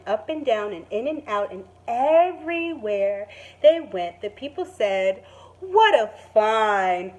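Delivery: gentle storybook narration; calm picture-book reading voice; reading to children. Acted. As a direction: read it like story time for kids. Teacher reads story ending aloud.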